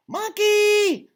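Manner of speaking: very high, loud shriek of fright; cat's scream. Acted. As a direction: shriek it like a terrified cat. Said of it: "screams the orange cat".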